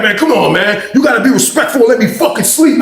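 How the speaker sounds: high whiny insistence; whiny demand. Retold or imitated. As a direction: demand respect. Cellmate demanding respect.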